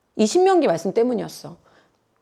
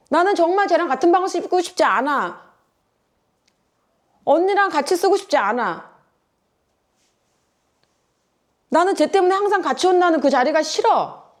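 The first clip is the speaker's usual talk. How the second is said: put-on sulky child; 5 semitones higher than usual. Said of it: "친구들은 그렇게 하지 않아요".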